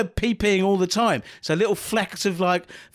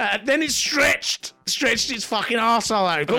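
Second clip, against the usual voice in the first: hammed-up reading; 5 semitones higher than usual. Exaggerated reading.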